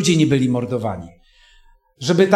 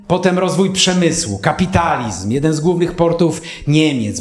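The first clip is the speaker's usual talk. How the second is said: ordinary talk, nothing marked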